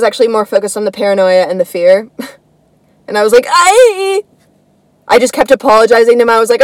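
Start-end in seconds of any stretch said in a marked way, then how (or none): 3.5-4.2 frightened squeak. Retold or imitated. Terrified when stopped by police.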